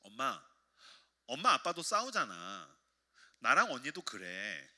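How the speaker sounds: lightly voiced child, slow and low; slow, drawn-out child's remark, retold; six-year-old daughter. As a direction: imitate a little girl gently reasoning with mum. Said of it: "여섯 살짜리가 이렇게 얘기하더라는 거예요".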